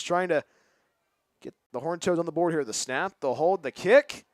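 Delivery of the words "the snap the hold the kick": building field goal call. Real, not acted.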